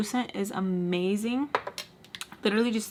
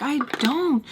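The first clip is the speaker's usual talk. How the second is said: retold yell; 6 semitones higher, 4 dB louder than usual. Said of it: "we start yelling at each other".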